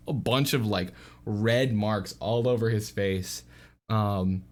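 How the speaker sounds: ordinary talk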